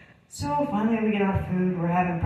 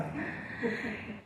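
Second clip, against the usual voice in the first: quiet breathy laugh; 3 semitones higher, 12 dB quieter than usual. Real, not acted. Laughs at own joke.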